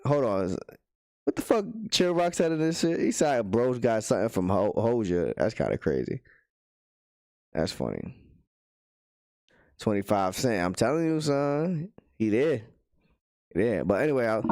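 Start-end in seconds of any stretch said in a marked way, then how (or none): none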